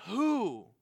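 anguished cry of a wretched man; Paul's lament. Retold or imitated. Paul crying out to be delivered from sin. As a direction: plead for rescue, voice straining up. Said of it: "he says".

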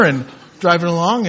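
normal talking voice

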